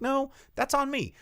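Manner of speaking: high, kind clerk voice; high lady voice. Retold or imitated. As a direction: chirp it like a friendly clerk.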